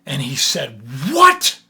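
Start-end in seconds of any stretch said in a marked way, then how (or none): 1.1-1.6 furious, much higher and louder outburst; enraged king shouting in disbelief; angry king. Acted. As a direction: explode in royal rage. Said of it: "the king was so angry".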